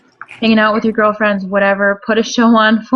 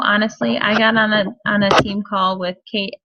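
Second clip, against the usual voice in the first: ordinary talk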